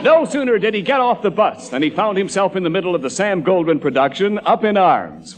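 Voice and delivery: usual speaking voice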